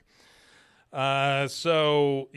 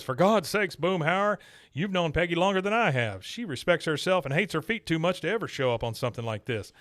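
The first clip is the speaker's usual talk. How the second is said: higher, indignant Texas voice; Hank impression; 5 semitones higher than usual. Podcaster voicing Hank scolding Boomhauer, higher and indignant. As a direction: defend Peggy the way Hank would. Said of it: "Hank says".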